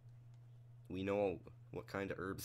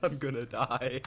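laughing panic; 4 semitones higher, 8 dB louder, slower than usual. Real, not acted.

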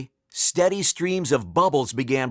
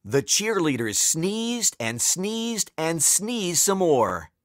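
plain reading voice

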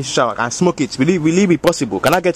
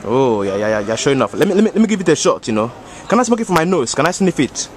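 put-on thick West African accent, easygoing; African uncle accent. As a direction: play a boastful African uncle. Same character voice.

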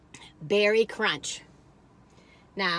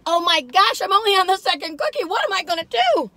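excited; 6 semitones higher, 5 dB louder than usual. Real, not acted. Excited about the cookies.

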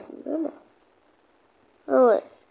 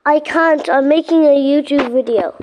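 loud frustrated shout; 8 dB louder than usual. Real, not acted. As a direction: shout it out louder, fed up. Shouting at other player.

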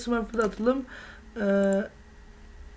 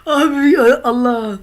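anguished cry; 8 dB louder, slower than usual. Real, not acted. Loud despairing cry at game.

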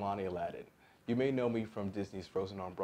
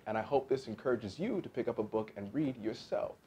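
usual speaking voice

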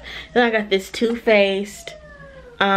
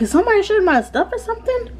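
much higher and fast, amazed; excited disbelief; 12 semitones higher, faster than usual. Retold or imitated. Creator amazed by sudden views, asks in high excited disbelief. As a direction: blurt it out, thrilled and baffled. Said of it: "I was like".

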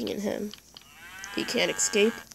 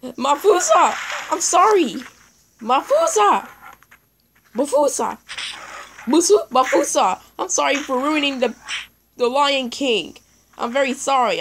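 higher, louder frantic apology; panicked pleading; 5 semitones higher, 6 dB louder than usual. Real, not acted. Begging Mafusa not to attack, panicked pleading. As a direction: beg the animal to stop.

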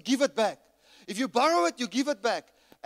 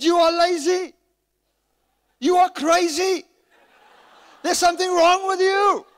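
furious shout, much higher and louder; 10 semitones higher, 12 dB louder than usual. Retold or imitated. Shouting at the church member who kept the chainsaw. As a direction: yell the accusations at him. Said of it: "so I shouted at this church member".

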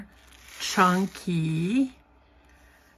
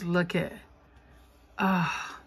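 ordinary delivery

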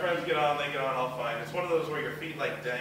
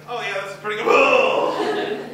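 mock delighted; 6 semitones higher, 10 dB louder, slower than usual. Tasting deviled eggs.

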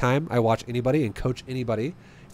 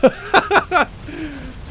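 high laugh; 14 semitones higher, 3 dB louder than usual. Real, not acted. Laughing at surprise win.

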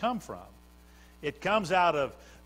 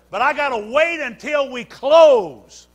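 louder, higher, building preacher's cadence; full-force preaching; 7 semitones higher, 10 dB louder than usual. Acted. Preacher rejoicing over the Holy Ghost as earnest money, full-force preaching. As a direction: stack the lines and lift the room.

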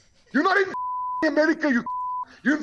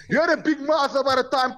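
usual speaking voice

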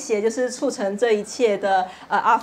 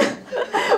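short laugh. Real, not acted. Laughing at a wish coming true.

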